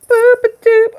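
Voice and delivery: high squeaky boop-a-doop, loud and playful; silly squeak. Pretend. Doing a yoga pose on stream, silly high squeak. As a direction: squeal it like a cartoon. Silly sound while posing.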